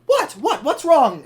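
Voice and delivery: an engine startled by the dent, high and alarmed; mock alarmed question. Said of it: "she's just like".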